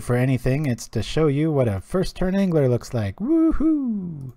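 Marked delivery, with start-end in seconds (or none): 3.2-4.3 high whoop of joy; whooping cheer. Real, not acted. Streamer showing off his first-turn Angler, whooping. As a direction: let out a big celebratory whoop.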